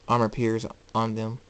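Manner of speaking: ordinary delivery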